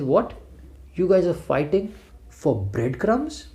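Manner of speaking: put-on a kid baffled by her parents' silliness; incredulous daughter. Daughter asking her fighting parents, high slow disbelief.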